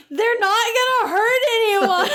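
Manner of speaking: high, laughing mock plea; pleading boy. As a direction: plead for them, half laughing. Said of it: "Michael's like".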